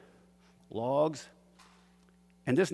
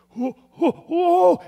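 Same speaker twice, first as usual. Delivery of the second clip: rising, louder and higher whoa with each repeat; excited yelling; 11 dB louder, much slower than usual. Retold or imitated. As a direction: yell like the fish is finally on. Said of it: "he's yelling".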